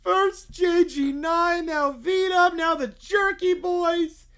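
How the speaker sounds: a lightly voiced crying kid listing grievances; crying child. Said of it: "D W begins crying".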